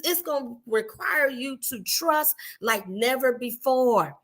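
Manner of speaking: ordinary talk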